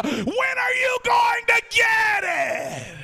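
high, slow, drawn-out shout; climactic shout. Shown as a demonstration. Pastor tells the church to ask a neighbor, slow high shout. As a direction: hurl the question up to the rafters. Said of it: "look at a neighbor and ask him".

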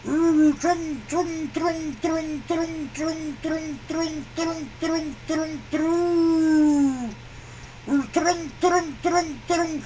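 trumpeting trung sounds like an elephant; rhythmic trunk-blast noises. Acted.

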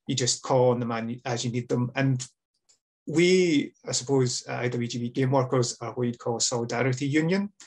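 ordinary talk, nothing marked

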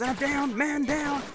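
high, loud alarmed call; excited shout. Real, not acted. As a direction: yell it out as you go down. Character downed in gameplay.

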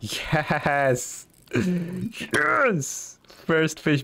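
thrilled cheering, voice jumping high; excited. Real, not acted. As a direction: shout it out with pure joy. First fish catch celebration.

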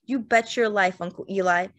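usual speaking voice

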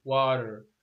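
American "water" with a tapped t and final r; American accent. Shown as a demonstration. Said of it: "والأمريكان يقولون".

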